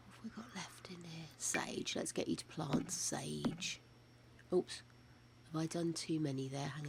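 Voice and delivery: soft whispered muttering to self; whispering. Real, not acted. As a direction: whisper it while thinking. Muttered gameplay aside.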